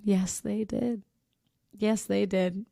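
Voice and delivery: slow, hushed confirmation; stifled amusement. Real, not acted. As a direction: say it softly, barely holding it together. Stifling laughter at the story.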